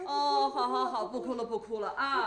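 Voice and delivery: put-on grandma hushing the baby in her arms; soothing baby talk. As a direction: rock the baby with your voice.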